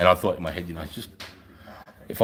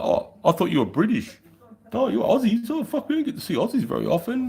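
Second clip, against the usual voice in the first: chatty Canadian; 13 semitones higher than usual. Retold or imitated. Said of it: "the Canadians are like".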